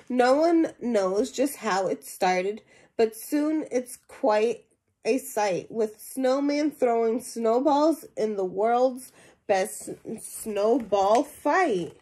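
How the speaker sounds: lively storybook reading to a class; animated read-aloud voice for young listeners; reading to children. Acted. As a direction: read it out like a picture book for kids. Teacher reads picture book aloud.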